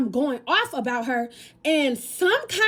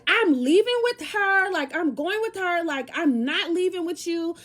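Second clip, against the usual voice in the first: defiant; 6 semitones higher than usual. Retold or imitated. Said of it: "So I end up being like".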